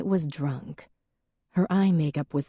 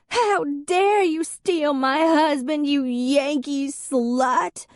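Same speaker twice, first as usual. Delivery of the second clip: put-on high, louder, slow drunken tirade; furious drunken accusation; 11 semitones higher, 4 dB louder, slower than usual. A narrator's reading. Drunk ex-wife accusing Em of stealing her husband, high furious tirade. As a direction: hurl the insult slowly.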